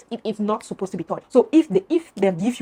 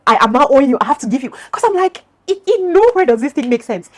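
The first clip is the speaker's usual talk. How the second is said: much louder and higher, exasperated; outraged disbelief; 6 semitones higher, 9 dB louder than usual. Real, not acted. Venting to viewers about the debt, loud exasperation. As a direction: push it up, totally fed up.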